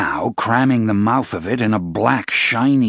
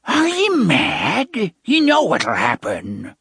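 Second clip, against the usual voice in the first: shocked witch voice; 10 semitones higher, slower than usual. Acted. Sister in shock.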